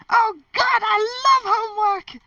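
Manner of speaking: very high, loud ecstatic scream; hammed-up ecstatic scream. A narrator's reading. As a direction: shriek it out in wild delight. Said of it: "Harry screamed".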